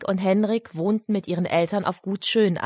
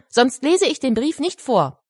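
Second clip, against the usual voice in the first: demanding; 7 semitones higher, 4 dB louder than usual. Acted. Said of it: "verlangte Henrik".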